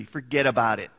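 tossing off "Forget about it" like a New Jersey guy; New Jersey accent. Retold or imitated. Preacher playing a New Jersey guy, New Jersey accent. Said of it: "Or from New Jersey".

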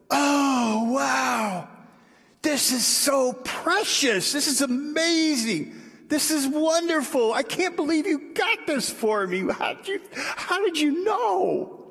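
high, gushing, amazed rich man; delighted Warren Buffett. Retold or imitated. Billionaire thrilled by a Secret Santa gift, high and gushing. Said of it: "and he goes".

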